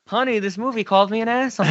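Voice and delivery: offended husband bit; mock outrage. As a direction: report the insult like a wounded moviegoer. Acted mock-outraged moviegoer quote.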